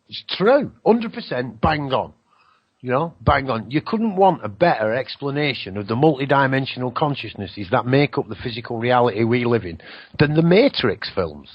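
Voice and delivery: ordinary delivery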